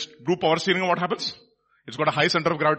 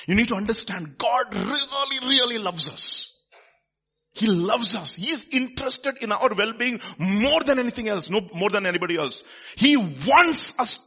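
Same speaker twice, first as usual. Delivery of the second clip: impassioned preaching; 5 semitones higher, 4 dB louder than usual. Real, not acted. Preacher assuring congregation God really loves them, impassioned. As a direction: stretch the big words with conviction. Emphatic preaching on God's love.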